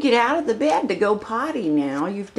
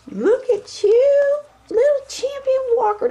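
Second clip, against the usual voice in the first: delighted gushing at the tiny walker; 4 dB louder than usual. Real, not acted. Owner laughing while praising her little champion walker, high baby talk.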